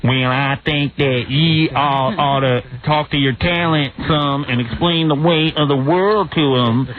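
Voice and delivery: slow, drawn-out hillbilly caricature; hillbilly accent. Retold or imitated. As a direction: imitate a hillbilly speechmaker, taking it slowly. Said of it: "That's hillbilly".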